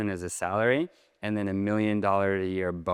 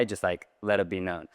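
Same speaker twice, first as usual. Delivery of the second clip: ordinary talk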